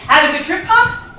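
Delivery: usual speaking voice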